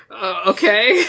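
high, baffled "Uh, okay"; unsure how to respond to that; awkward okay. Retold or imitated. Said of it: "I was just like".

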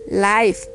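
LIFE con diptongo "ai"; pronunciación modelo. Shown as a demonstration. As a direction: di LIFE como "laif". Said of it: "Se pronuncia I cuando precede". (LIFE con la i sonando "ai")

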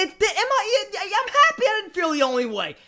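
high, laughing relief. Real, not acted. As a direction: laugh through the words. Laugh heard.

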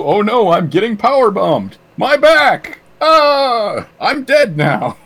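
hammed-up ad victim cries; mock pain. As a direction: overact getting slammed. Mock getting power bombed.

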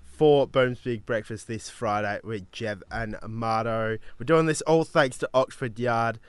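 loud, punchy DJ announcement over music; radio DJ read. Acted. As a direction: sell the station like a breakfast DJ. DJ back-announcing the song.

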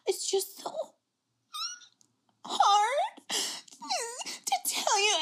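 sigh, whispered, then high and teary in mockery; mock fake crying. Friend parodies Julia's fake tears on camera, mock crying. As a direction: overact a fake sob to parody her. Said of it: "she was doing this thing where she was just like".

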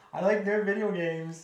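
dragged-out imitation of lisped speech; self-mocking lisp. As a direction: exaggerate your own lisp. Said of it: "me with a stutter and a lisp".

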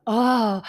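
a high, loud release of breath; released sigh of relief. Retold or imitated. Describing the relief of coming home to herself, high loud oh. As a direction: let it all go in one cry. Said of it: "like a".

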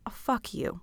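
breathy, almost voiceless brush-off; airy defiant brush-off to critics; mock dismissive retort. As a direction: toss it off with a sneer. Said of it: "And to that I say".

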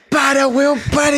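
yelling stop in a panic; panicked shout. Retold or imitated. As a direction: shout it, high and loud. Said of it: "yo transpiré este weón".

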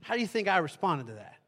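usual speaking voice

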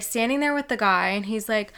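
ordinary talk

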